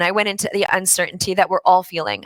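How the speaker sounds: ordinary talk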